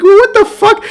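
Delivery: high, squeaky incredulous reaction; mock disbelief. Player just looking at Judy, exaggerated disbelief. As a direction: pitch it way up, hammed-up bewilderment.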